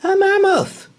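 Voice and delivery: high, loud gasp of alarm; startled cry. Acted. Boy sees a mammoth, high loud alarm. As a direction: shriek it at the sight. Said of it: "said George".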